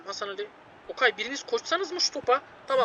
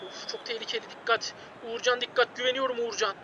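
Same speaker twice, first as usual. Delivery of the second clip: tense murmur; 8 dB quieter than usual. Real, not acted. Tense moment, goalkeeper needed.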